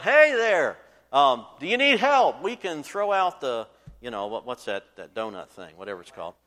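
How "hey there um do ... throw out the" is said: loud, higher hail across the water; yelling out. Retold or imitated. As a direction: call out from the passing ship. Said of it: "they yelled out".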